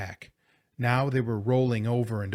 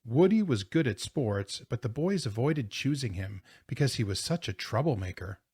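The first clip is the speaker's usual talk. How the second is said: plain reading voice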